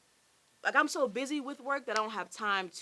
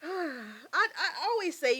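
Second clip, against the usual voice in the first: slow, high, teary laugh-sob; tearful; 7 semitones higher, 3 dB louder, slower than usual. Real, not acted. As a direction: speak through tears with a sigh. Still crying after the surprise.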